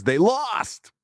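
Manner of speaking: high, teasing jab; quoting the Pope. Retold or imitated. Pope teasing a Cubs fan about their loss, high playful jab. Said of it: "and then says".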